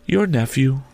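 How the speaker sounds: high, airy spirit voice; high otherworldly voice; ghost. A narrator's reading. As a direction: speak as a ghost, pitched high and measured. The ghost's voice in narration.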